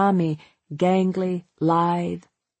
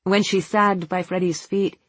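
usual speaking voice

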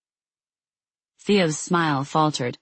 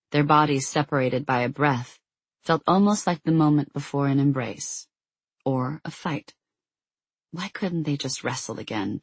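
ordinary delivery, nothing marked

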